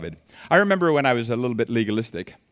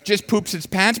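ordinary talk

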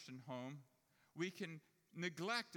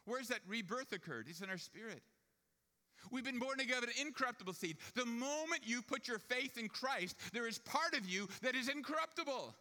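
raised, louder pulpit voice; fervent preaching; 9 semitones higher, 5 dB louder than usual. Acted. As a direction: proclaim it with full conviction. Preaches at full force.

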